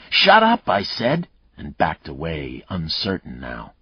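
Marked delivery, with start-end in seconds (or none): 0.1-1.3 loud, high angry shout. Acted. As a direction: yell over the crying girl. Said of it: "and Mayle shouted".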